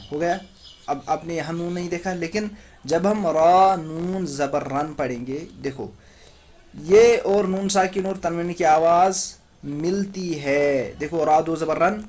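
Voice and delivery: ordinary delivery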